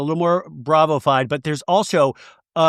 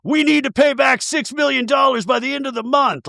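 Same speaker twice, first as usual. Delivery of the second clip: mock dramatic announcement; 9 semitones higher than usual. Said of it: "they'll be like".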